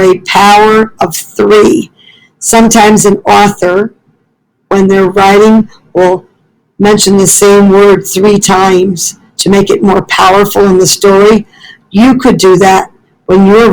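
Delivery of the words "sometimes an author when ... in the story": plain reading voice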